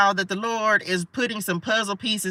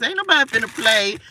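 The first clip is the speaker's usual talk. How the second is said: ordinary delivery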